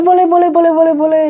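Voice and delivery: eager agreeing. Retold or imitated. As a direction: gush out a happy yes. Anissa agreeing to a photo.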